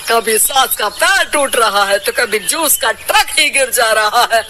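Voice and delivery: usual speaking voice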